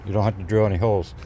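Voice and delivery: ordinary delivery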